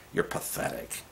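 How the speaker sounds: breathy, whispered contempt for "You're pathetic"; whispered scorn. Shown as a demonstration. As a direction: hiss "You're pathetic" under your breath. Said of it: "start talking like that to politicians".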